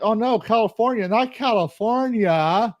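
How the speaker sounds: high-pitched, drawn-out fake lament; hammed-up sarcastic distress; sarcastic mock dismay. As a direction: wail it in exaggerated, insincere horror. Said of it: "I mean that sarcastically".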